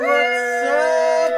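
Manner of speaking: loud high greeting yell; hype shout. Acted. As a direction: scream the hello to the crowd. Show intro hype.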